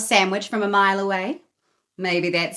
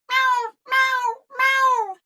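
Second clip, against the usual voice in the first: very high, louder miaow; demanding cat meow; 5 dB louder, much slower than usual. A narrator's reading. Greedy Cat demanding food from Katy, high loud meows. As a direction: cry like a hungry cat.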